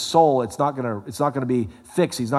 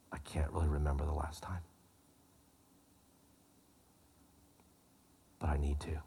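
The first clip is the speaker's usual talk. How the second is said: much softer, ending in a whisper; quiet confession; 7 semitones lower, 12 dB quieter than usual. Retold or imitated. Counselor admitting to the preacher he has not confessed, in a quiet voice fading to a whisper. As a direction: admit it under your breath.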